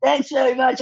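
playfully sarcastic thanks, voice lifted; joking tone. Retold or imitated. Actress teasing her co-star after crying, joking tone. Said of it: "in a very joking tone".

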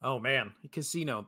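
talking with food in mouth; words round a mouthful of food; mouth full. Real, not acted. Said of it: "there's something in my mouth".

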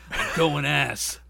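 slow, gritty movie hero line; action hero. Shown as a demonstration. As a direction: deliver it like a blockbuster tagline. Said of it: "It sounds like an action hero".

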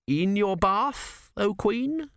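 high, slow, feeble query; weak, faltering. A narrator's reading. Bewildered official questions his queen, weakly. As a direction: ask it weakly, drawing it out high. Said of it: "said Kumi weakly".

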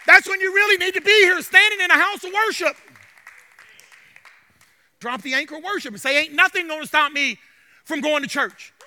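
urgent, rising sermon delivery; fervent preaching. Real, not acted. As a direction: press the congregation with conviction. Preaches on coming to church.

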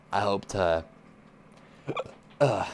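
hiccuping mid-sentence; suppressing hiccups. Real, not acted. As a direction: hiccups break the words. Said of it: "sorry, I have the hiccups".

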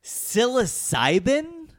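rising, slowing, incredulous questions; mounting surprise. Real, not acted. Escalating mock shock.

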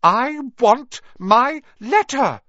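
slow, high furious shout; drawn-out angry yell. A narrator's reading. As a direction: yell each word as a demand. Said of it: "he shouted".

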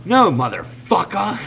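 laughing imitation of a drugged woman's retort; mock angry preacher. Retelling the preacher lady's reply, laughing mock snap. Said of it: "she's like".

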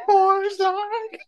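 very high falsetto squeak; high silly voice. Pretend. Echoing the falsetto joke.

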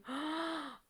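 sharp unvoiced gasp of surprise; quick breathy gasp on seeing something; whispered gasp. Real, not acted. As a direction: catch your breath in a hushed gasp. Sudden gasp at something seen.

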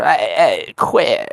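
mock a Brit heckling someone; British accent. Podcast host mimicking what the British say, British accent. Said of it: "Yeah, the British are".

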